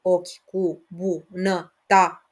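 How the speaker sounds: very slow, syllable by syllable; split into syllables. Shown as a demonstration. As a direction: count each syllable out deliberately.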